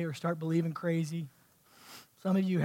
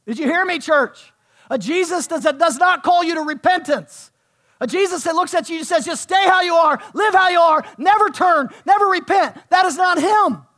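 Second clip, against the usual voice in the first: high, shouted pulpit exhortation; fiery shouting in short hammered phrases; full-force preaching; 10 semitones higher, 13 dB louder than usual. Real, not acted. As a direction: drive each phrase home to the church.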